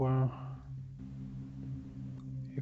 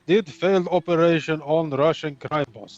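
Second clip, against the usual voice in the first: put-on light Russian accent, broken English; clipped Russian-accented tough guy. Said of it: "You wanted my Russian accent".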